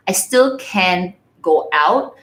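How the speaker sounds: ordinary delivery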